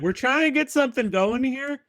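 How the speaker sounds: high-pitched mock frat bro; mock frat guy. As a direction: send up the eager frat boys. Frat guys voiced in story.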